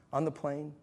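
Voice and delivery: usual speaking voice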